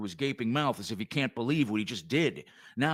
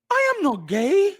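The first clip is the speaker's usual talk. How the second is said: much higher and louder, squeaky protest; outraged denial; 8 dB louder than usual. Acted. Short cop protests the insult, high-pitched outrage. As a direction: shriek the denial in offended shock.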